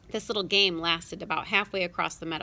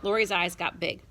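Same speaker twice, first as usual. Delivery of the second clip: plain reading voice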